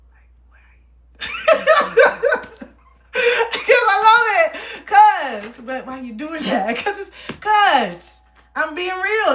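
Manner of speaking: high, loud laughing plea. Real, not acted. Laughing and pleading with a confusing commenter. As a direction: laugh through it, half crying.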